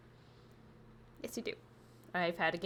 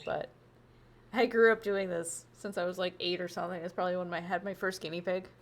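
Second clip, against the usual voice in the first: usual speaking voice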